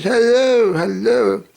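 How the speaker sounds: high, loud sing-song hello; mimicking her excited hello, high and loud; her bright greeting. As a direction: greet him high and bright, like she's thrilled. Her greeting acted in story.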